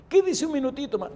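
súplica imitada en voz muy aguda; enfermo suplicante. Retold or imitated. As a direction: imita al viejo rogando por un ratito. Enfermo suplica otro minuto. (ruego agudo del enfermo)

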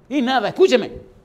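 grito imperioso y agudo; orden severa. Acted. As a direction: exige atención de golpe, muy fuerte. Vuelve al regaño del enfermo. (orden tajante a gritos)

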